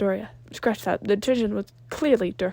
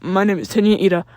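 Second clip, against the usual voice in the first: loud formal announcement; 8 dB louder than usual. A narrator's reading. Ida introduces himself.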